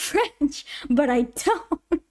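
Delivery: ordinary talk, nothing marked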